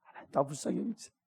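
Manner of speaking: quiet earnest deacon's prayer; soft prayer. Shown as a demonstration. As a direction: murmur the plea to God under your breath. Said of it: "박형 집사님".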